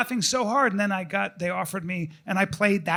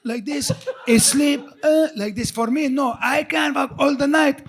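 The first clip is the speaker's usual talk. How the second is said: imitating a Middle Eastern accent, bragging, laughing; friend's accent; 3 semitones higher, 5 dB louder than usual. Mocking his friend Tawfiq's bragging in a Middle Eastern accent. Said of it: "That's how he used to talk".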